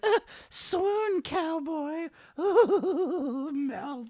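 high, breathy-sounding mock swoon with a giggle; swooning dame. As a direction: play a lovestruck girl melting. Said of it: "And she's like".

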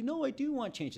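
high-pitched mock client insisting. Client protesting to the coach, pitched high. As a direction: chirp it up like an eager client.

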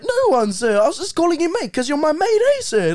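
high, hurried kid imitation; pleading innocence. New kid covering for his mates, pleading. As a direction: re-enact a kid hastily explaining himself to sir.

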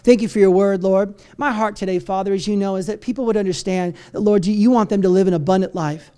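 soft, low prayer voice; hushed pastor's opening prayer. Real, not acted. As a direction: pray quietly and earnestly to God. Pastor prays before sermon.

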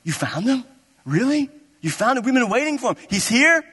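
acted mounting amazement, climbing in pitch; getting excited. Nathanael hears from Philip that he's here, increasingly excited. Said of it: "like, getting excited".

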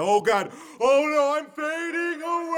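very high loud wail; overacted death scene. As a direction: panic then wail high. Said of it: "I could fade back with them and say".

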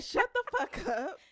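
mock snap. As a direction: tell him off, drawn out. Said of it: "I would've said".

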